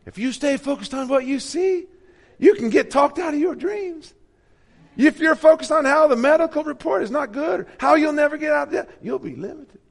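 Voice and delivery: high, loud, bright preacher imitation; mock the smooth TV preacher; Joel Osteen impression. Mocking Joel Osteen to the congregation, high and loud. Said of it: "Not many voices I can impersonate".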